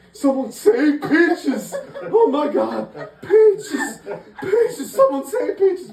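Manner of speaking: high, loud mock-panicked wail; hammed-up frantic yelling. Storyteller mimicking owner screaming for his drowning dog, mock frantic yell. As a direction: wail for help, comically overdone.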